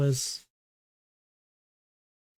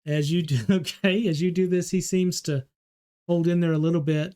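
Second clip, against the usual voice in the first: normal talking voice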